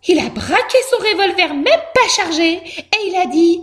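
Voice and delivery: high mouse voice, building up; excited mouse. A narrator's reading. Mouse telling father the exploit, high and excited. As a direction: tell it proudly in a high squeak.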